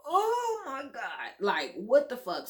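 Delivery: drawn-out, high-pitched "oh my God"; pained exclamation. Retold or imitated. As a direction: wail it high and slow, retelling the pain. Acting out her reaction to pain.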